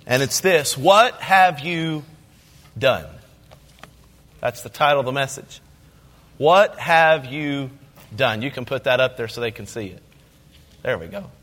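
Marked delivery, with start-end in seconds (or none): 0.8-3.1 each word drawn out slowly; slow, weighty question; slow and deliberate. Shown as a demonstration. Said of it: "When I say something really slow twice". 6.4-8.4 drawn out slowly as a cue; slow, weighty question; slow and deliberate. Shown as a demonstration. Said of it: "When I say something really slow twice".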